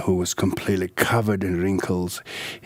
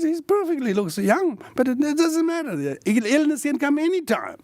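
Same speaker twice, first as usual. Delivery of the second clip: lightly voiced attendant. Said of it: "He says".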